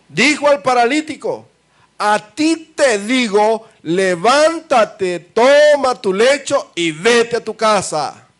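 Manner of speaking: high, loud, slow proclamation of Jesus' words; preaching at full force. Acted. Preacher voicing Jesus to the paralytic, loud and slow. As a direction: thunder it out like the Lord commanding.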